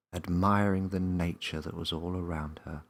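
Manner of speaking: usual speaking voice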